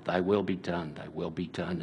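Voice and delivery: low, hushed quoted prayer; quiet submission. Retold or imitated. Offering submission to the Father, low and quietly yielding. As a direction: voice Jesus' surrender softly, dropping away.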